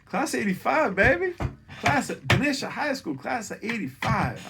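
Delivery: very high, loud whooping shout-out; exuberant cheer. Real, not acted. Comic cheering his high school class, high whoop.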